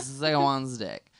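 ordinary delivery

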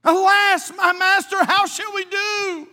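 high, loud, slow anguished plea; passionate cry. Acted. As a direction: plead with your master in panic. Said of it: "He's saying this with some passion".

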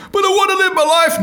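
high shouted plea, hammed-up; over-the-top high wail of protest; mock desperate protest. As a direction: yell it as a comic victim begging. Victim protests in acted argument.

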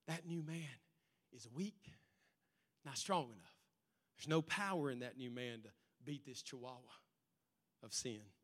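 hushed taunting voice; low soft tempting murmur. Retold or imitated. Enemy whispering to believers, low and soft. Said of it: "Your enemy whispers to you".

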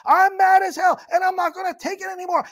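high shout, easing as it goes; yelling from the window. Acted. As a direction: cry out like the fed-up movie character. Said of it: "yell at the top of your voice".

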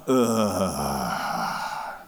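low, weary groan; a defeated imitated groan; overwhelmed groan. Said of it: "if you didn't go".

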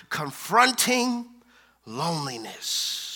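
lingering, sinking to a hush on loneliness; confronting pulled long, loneliness falling soft; slow drawn-out. Shown as a demonstration. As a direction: stretch confronting slowly, then let loneliness drop quiet. Preacher drawing out the series title.

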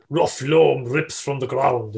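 slow mock-poetic recital; Heaney-style verse. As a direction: draw out each earthy word like a solemn poet. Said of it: "that's pure Heaney".

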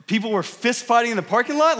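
acted-out astonishment; incredulous. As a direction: repeat it back as a stunned question. Retold shocked reaction to the mumble.

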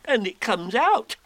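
high, eager little voice; Piglet. Acted. Piglet watching the balloon come out, small high voice. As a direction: pipe up, small and loud.

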